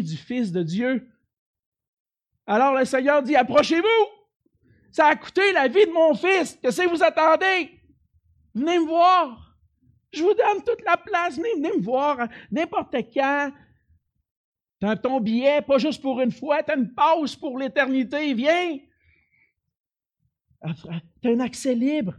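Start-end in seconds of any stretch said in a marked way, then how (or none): 2.5-3.4 loud preaching. Acted. Preacher voicing God's call. 3.4-22.1 high, loud pleading call; God's voice calling. Acted. Preacher voices God calling.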